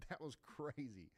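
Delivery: laughing it off after a jump scare. Real, not acted. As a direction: chuckle through the words.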